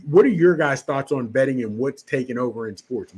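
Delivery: ordinary delivery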